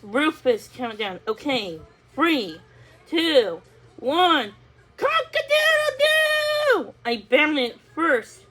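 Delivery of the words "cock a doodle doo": long high crowing rooster call; drawn-out high cock-a-doodle-doo. A narrator's reading. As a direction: crow it out like a rooster. Rooster crows race start.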